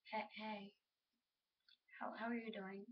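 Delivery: soft, halting, tongue-tied greeting; acted shyness, stumbling quietly over the words; shy, speechless. Girl speechless that you're talking to her, shy. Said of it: "they're, like, speechless that you're talking".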